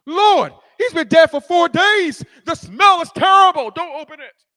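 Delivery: loud, high urgent protest. Acted. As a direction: object to the Lord as Martha, in alarm. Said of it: "gets in the way and protests".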